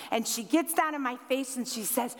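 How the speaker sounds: ordinary delivery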